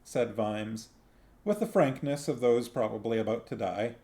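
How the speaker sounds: ordinary delivery, nothing marked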